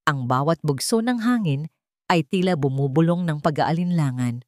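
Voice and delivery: plain reading voice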